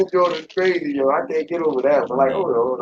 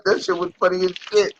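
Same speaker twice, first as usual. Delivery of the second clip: ordinary talk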